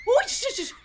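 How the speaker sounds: jump-scared and blurting it out; startled shout. Real, not acted. Player jolted by a scare, yelling in fright. Sudden scare in horror game.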